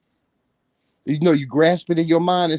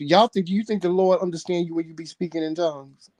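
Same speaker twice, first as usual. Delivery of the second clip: normal talking voice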